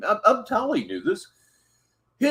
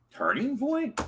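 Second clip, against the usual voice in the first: incredulous; 10 semitones higher, 5 dB quieter than usual. Real, not acted. Baffled by the same title.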